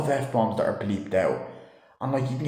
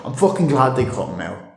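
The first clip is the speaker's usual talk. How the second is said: normal talking voice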